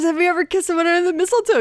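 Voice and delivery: high, fast, innocent mock repeat. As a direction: replay it high and quick, all innocence. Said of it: "All I asked was".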